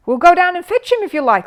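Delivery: high, loud eager offer. Acted. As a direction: jump at the chance to help. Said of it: "said Jonathan eagerly".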